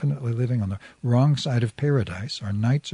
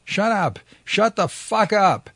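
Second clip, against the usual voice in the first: much higher, louder raging scream; furious screaming; 8 semitones higher, 3 dB louder than usual. A narrator's reading. Master screaming at her to shut up, enraged. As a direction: scream it at her in pure rage.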